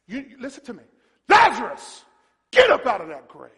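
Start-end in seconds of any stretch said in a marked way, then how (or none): 1.3-3.5 big punched shout, then falling; commanding shout. Acted. Preacher showing the power of a shout to Lazarus, commanding. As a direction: shout with full authority. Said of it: "when you shout".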